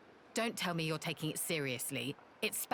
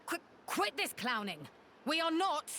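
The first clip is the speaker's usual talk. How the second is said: high, stammering objection; protest, cut short and flustered; 10 semitones higher than usual. Acted. Quarreller objecting to the meddler's clowning, flustered.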